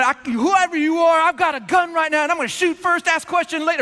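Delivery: high, heated mock yell; retold screaming. Dad warning off a prowler, high mock screaming. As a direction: yell a threat like an alarmed protective dad. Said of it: "he's screaming at the guy".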